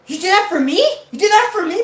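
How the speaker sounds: high, loud, gushing disbelief; amazed gratitude. Retold or imitated. Scared kid thanks his protective buddy, ecstatic amazement. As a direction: gush it, thrilled and incredulous.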